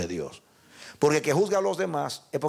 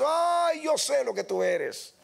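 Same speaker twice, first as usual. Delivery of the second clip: a high "Ay", then a knowing rebuke; Jesus rebuking; 11 semitones higher, slower than usual. Acted. Jesus answering the boaster, a high sighing rebuke.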